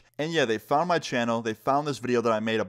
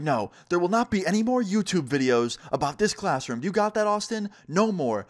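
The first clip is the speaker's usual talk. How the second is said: higher-pitched emphatic forbidding; mock-outraged ban in a raised pitch; stern teacher; 6 semitones higher than usual. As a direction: imitate a teacher laying down the law. Teacher interrupts, forbids further videos.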